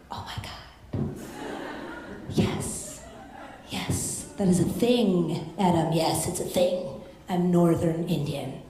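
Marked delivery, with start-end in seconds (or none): 0.1-4.3 breathy whisper to the crowd; exasperated under-the-breath whisper; whispered exasperation. Real, not acted. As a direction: hush it out in disbelief. Exasperated aside.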